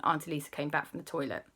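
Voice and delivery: usual speaking voice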